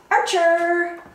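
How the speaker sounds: ordinary talk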